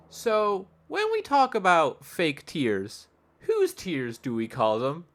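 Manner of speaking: slow, measured mock cross-examination; courtroom lawyer. Steve Irwin's lawyer questioning the crocodile, slow theatrical delivery. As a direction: draw out the question for the jury. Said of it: "Steve Irwin's lawyer. He's like".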